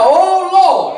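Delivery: high, drawn-out preacher's cry; retold shout. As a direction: call out as the blind men shouting. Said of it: "they shouted out".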